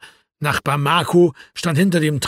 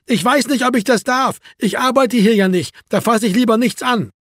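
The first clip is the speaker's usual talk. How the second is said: high-pitched, raised refusal; calling back; 7 semitones higher, 4 dB louder than usual. A narrator's reading. Marco refusing Erwin responsibility for the door, calling back. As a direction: make excuses through the glass.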